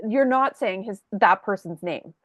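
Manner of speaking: ordinary talk, nothing marked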